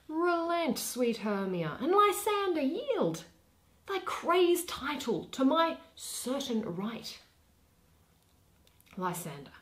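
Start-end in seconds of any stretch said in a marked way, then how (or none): 0.1-7.2 higher, pressing demand to yield; indignant young suitor. Acted. Demetrius pressing Lysander to give up Hermia, indignant. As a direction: insist hotly on your claim.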